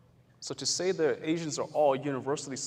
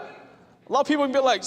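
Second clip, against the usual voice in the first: normal talking voice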